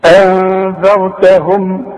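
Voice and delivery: slow recitation with hidden nasal n; tajweed recitation. Shown as a demonstration. Teacher demonstrating ikhfa to students, slow recitation. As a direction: chant أنذرتهم slowly, hiding the نون into the ذال. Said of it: "شوف".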